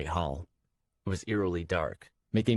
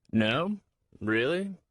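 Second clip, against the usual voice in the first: playful disbelief, pitched up; teasing; 8 semitones higher, 3 dB louder, much slower than usual. A narrator's reading. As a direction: tease her lightly. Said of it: "he teased".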